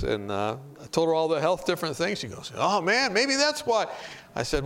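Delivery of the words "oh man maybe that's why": retold sudden realisation. Passenger hearing pork health facts, suddenly realising. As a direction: imitate a dawning realisation, rising much higher.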